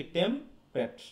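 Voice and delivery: "টেম্পারেট" slowly as tem, pret; two syllables apart. Shown as a demonstration. Teacher breaking down the fast form, "টেম্পারেট" as tem... pret. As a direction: pause between "tem" and "pret", no middle vowel. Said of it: "এরকম হয়ে যাচ্ছে কিন্তু উচ্চারণ".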